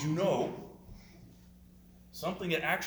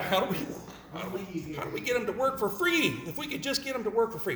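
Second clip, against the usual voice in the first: greedy boss musing; 6 semitones higher, 3 dB louder than usual. Pretend. Voicing greedy employers after joke.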